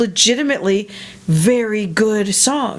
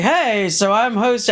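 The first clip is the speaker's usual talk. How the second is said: mock talk show host; 2 semitones higher, slower than usual. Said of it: "'Cause I noticed you're like".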